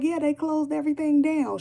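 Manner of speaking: high, shocked retelling of a friend; lightly voiced shocked homegirl. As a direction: mimic the friend's disbelief. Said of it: "She was shocked".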